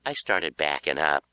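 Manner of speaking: plain reading voice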